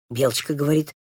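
ordinary delivery

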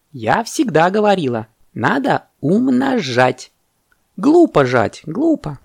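high-pitched, emphatic monkey voice; delighted monkey. Acted. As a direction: exclaim it with swooping glee. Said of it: "обрадовалась мартышка".

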